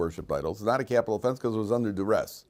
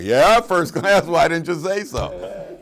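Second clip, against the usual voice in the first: slow, high, loud hammed-up glee; laughing, drawn-out mock excitement; mock greedy delight; 7 semitones higher, 7 dB louder, slower than usual. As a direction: stretch it out like you just won.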